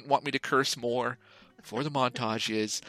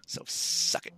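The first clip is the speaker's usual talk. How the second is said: whispered taunt; 5 dB quieter, slower than usual. Real, not acted. Teasing jab after soft line.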